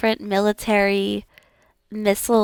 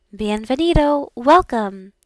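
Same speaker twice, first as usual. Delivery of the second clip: ordinary talk, nothing marked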